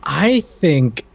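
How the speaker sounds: ordinary talk, nothing marked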